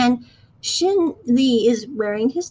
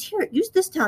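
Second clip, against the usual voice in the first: a higher, sing-song "here"; lightly voiced, higher offer; 8 semitones higher than usual. Shin Lee hands the towel over, higher and light. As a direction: hand it over in a bright higher voice. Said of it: "he just grabs it and says".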